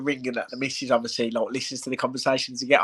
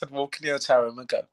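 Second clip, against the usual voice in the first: ordinary talk, nothing marked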